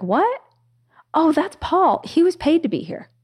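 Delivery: high, lilting punchline as the guru; imitating a breezy, puzzled "oh, him"; nonchalant surprise. Guru's surprised punchline, laughter follows.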